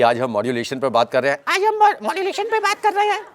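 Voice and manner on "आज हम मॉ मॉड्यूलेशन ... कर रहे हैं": very high squeaky put-on voice; voice change. Shown as a demonstration. Trainer parodies modulation for students, absurdly high changed voice. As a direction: jump far up into a piping high pitch. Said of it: "voice change".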